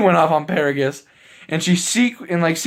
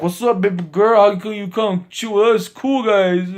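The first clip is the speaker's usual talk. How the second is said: higher, slurry put-on drunk; drunk guy voice; 6 semitones higher than usual. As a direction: play a sleazy drunk hitting on her. Drunk guy hits on Chile.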